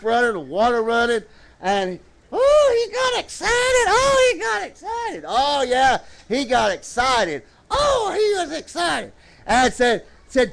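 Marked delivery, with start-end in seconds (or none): 2.7-5.2 very high puppet squeal; excited puppet. Pretend. Puppet Rowdy cuts in excitedly. 7.7-9.1 high-pitched puppet chiming in; excited puppet. Pretend. Puppet Rowdy chimes in again.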